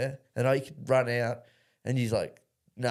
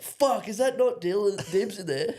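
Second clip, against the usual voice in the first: high, slow, shocked call through the door; startled shout; 9 semitones higher, slower than usual. Retold or imitated. James realising he soaked the wrong mate, shocked. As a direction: mates imitating James finding the wrong guy. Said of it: "And James is like".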